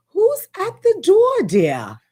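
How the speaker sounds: put-on high, slow posh English accent. To her viewers, asking who's knocking in a prim English accent. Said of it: "You have to get my English accent there".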